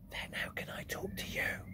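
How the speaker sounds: put-on girl unsure how to speak to the giant; whisper. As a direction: whisper it helplessly. Sophie whispering.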